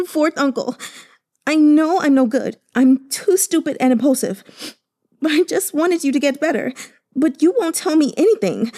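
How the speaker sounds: high, tearful pleading; weeping nephew begging his uncle; crying. Acted. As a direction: plead through tears, voice high and breaking. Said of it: "tears flowing down his face".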